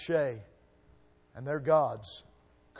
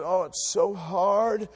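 mock whining; 4 semitones higher, 4 dB louder, slower than usual. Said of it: "sometimes we Christians".

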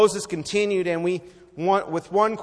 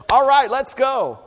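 eager Moses; 7 semitones higher, 9 dB louder than usual. Retold or imitated.

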